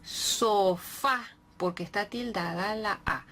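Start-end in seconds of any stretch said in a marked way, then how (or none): none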